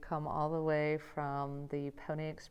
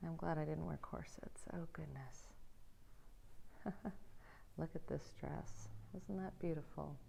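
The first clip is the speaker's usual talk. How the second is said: whispered aside; 15 dB quieter than usual. Real, not acted. Quiet aside in a museum.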